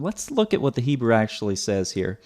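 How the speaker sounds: usual speaking voice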